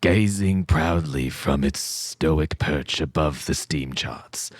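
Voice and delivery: slow, low haunting narration; spooky voice. Acted. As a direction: drag it out like a doom-laden narrator. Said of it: "Do it with a voice".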